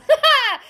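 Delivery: loud, high burst of laughter; real loud laugh. Real, not acted. Laughs at own blunt line.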